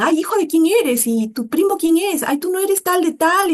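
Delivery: lampoon the nosy well-connected Peruvian; mocking society lady. Lima socialite quizzing a young person about family, mock sing-song. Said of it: "Entonces te dicen".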